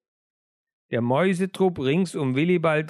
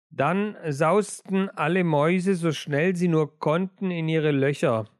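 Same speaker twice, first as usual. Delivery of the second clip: usual speaking voice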